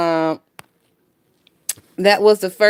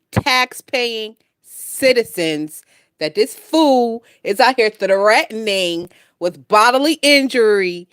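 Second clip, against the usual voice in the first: usual speaking voice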